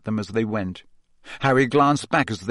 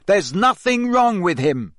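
high, raised angry shout; 7 semitones higher, 4 dB louder than usual. A narrator's reading. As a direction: defend a friend, loudly. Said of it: "Harry shouted".

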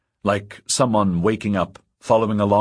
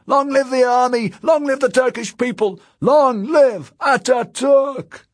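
crying out; 5 dB louder than usual. A narrator's reading. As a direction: bellow the slogan like a ranting dictator. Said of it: "he cried".

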